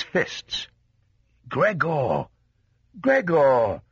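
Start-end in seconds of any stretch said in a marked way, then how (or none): 1.5-3.8 father's raised, calling voice; father calling through the door, falling at the end; crying out. A narrator's reading. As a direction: call the name out insistently, pitched up. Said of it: "he cried".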